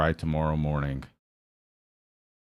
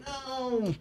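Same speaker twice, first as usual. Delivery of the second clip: mock scolding no; 6 dB quieter than usual. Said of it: "And everybody".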